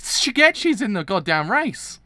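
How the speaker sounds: loud, high and slow, stretched out in disbelief; excited shout. Real, not acted. Reader realises a character survived, loud shocked shout. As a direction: shout it out, stunned and thrilled. Sudden realisation while reading.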